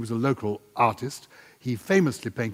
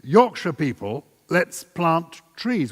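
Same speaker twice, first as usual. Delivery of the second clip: slow, raised, emphatic call to action; slow public proclamation, higher and louder; rallying appeal; 5 semitones higher, 4 dB louder, much slower than usual. Real, not acted. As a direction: address the crowd, drawing out each word.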